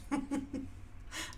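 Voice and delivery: soft breathy chuckle; quiet real laughter; giggle. Real, not acted. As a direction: giggle at your own cheeky remark. Laughs at own joke summary.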